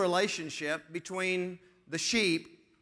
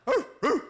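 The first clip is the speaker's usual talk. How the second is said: animal hoots; 8 semitones higher, 6 dB louder than usual. Retold or imitated. Animal noise game.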